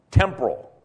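normal talking voice

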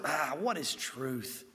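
low, soft, slow dismissive reply; slow, hushed, offhand musing; Pontius Pilate. Acted. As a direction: wave the question away with a drawn-out sigh of words. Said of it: "Pontius Pilate standing in front of".

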